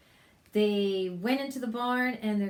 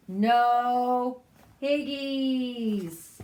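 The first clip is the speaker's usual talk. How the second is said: very slow, loud drawn-out chicken voice; 4 semitones higher, 8 dB louder, much slower than usual. Acted. Disguised pig playing chicken, slow and loud. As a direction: drag it out like a clucking hen.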